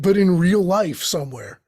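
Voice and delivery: ordinary talk, nothing marked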